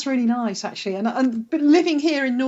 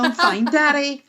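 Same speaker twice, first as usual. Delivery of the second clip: put-on a bit higher, slow, lightly childlike Northern Irish girl; little girl answering dad; 4 semitones higher, 3 dB louder than usual. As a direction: sweetly play the small daughter on the phone.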